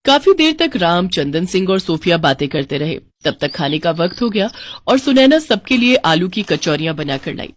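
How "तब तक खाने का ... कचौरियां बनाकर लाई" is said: usual speaking voice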